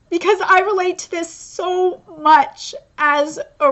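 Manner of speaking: deeply touched, crying while saying thanks; tearful. Real, not acted. Reader thanks the author who might be watching, in tears. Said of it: "it's really making me cry".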